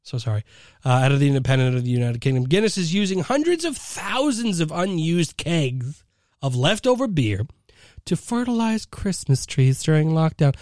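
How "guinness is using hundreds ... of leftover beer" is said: ordinary talk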